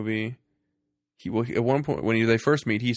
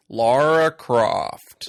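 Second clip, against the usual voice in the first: slow, much louder mock bad American accent; 2 semitones higher, 11 dB louder, much slower than usual. Mocking Daniel Craig's bad American accent, slow and loud. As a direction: drawl the name out in a clumsy American accent. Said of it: "It's like".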